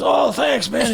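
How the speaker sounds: high-pitched mock thanks; grateful stranger. Stranger thanks him for the warning, mock grateful.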